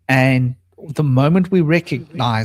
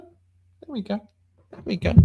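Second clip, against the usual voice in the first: soothing coo; 6 semitones higher, 11 dB quieter than usual. Real, not acted. Settling the pet bird.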